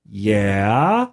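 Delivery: low, drawn-out questioning yeah; puzzled prompting. Retold or imitated. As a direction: imitate baffled listeners, starting low and swooping up. Players still waiting for the point.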